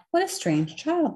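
put-on wondering aloud at an odd child; disapproving villagers. A narrator's reading. Villagers passing a strange child, disapproving. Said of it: "they would say".

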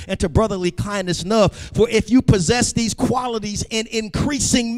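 high-pitched, driving sermon delivery; impassioned preaching. Real, not acted. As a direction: preach it with rising force through the scripture.

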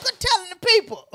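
high, loud mock scold; playful loud scolding. Real, not acted. Co-host scolding him for telling listeners, high loud playful shout. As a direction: shush him loudly with a laugh.

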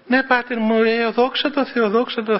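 high cheerful reply. Retold or imitated. Giorgos voiced again.

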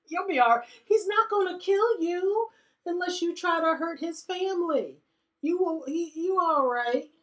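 high, soothing voice for the scared butler; high reassurance. Pretend. Nathan's player calming the scared butler, high and soothing. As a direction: coax him gently in a high voice. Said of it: "The butler is just totally like".

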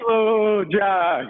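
loud, drawn-out drill call; called-out command. Real, not acted. Instructor slowing the class after a sprint, loud called-out command. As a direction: project it over the exercising class. Calling a change of drill pace.

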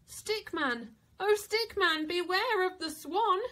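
warning refrain. A narrator's reading. Narrator warning the floating Stickman, calling.

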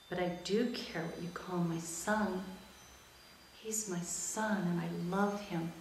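a hint of a quiet, caring mom; mother's gentle voice. Mother gently scolding daughter.

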